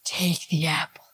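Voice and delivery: a hushed, seductive serpent-like whisper; tempting whisper. Retold or imitated. Imitating the tempting woman to the viewers, whispered. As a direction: lure her in under your breath. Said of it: "This woman is like".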